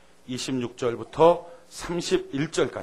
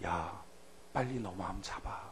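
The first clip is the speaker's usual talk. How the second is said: soft whispered warning; urgent whisper; 7 semitones lower, 12 dB quieter, slower than usual. Acted. Preacher voicing God warning a believer, in an urgent whisper. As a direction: hush it like a secret command.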